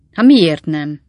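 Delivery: loud, indignant why not; defiant outburst at his son; loud retort. Acted. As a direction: throw the question back hard. Old man arguing with son.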